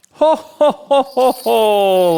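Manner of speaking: high, loud jolly ho ho ho; Santa chuckle. A narrator's reading. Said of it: "laughed Santa".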